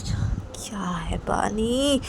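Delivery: slow, whispery, drawn-out question; hushed soothing. Acted. Chhoti maa turns to weeping Bani, hushed coaxing. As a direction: coax gently, stretching the words.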